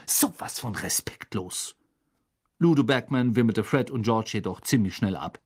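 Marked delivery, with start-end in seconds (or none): 0.1-1.8 softer, indignant aside; hissing disapproval. A narrator's reading. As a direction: mutter the disapproval under your breath. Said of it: "zischte Percy".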